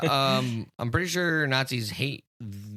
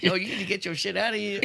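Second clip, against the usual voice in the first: quick high laughing impression of D from Sunny; D from Sunny, half laughing; 6 semitones higher, 4 dB quieter, faster than usual. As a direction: rattle it off in a higher, faster mock voice. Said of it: "I know exactly what you're talking about".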